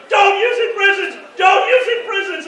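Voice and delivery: high, loud frantic yell; imitating people yelling at full lung; retold screaming. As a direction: scream the warning across the battlefield. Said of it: "screaming at the top of their lungs".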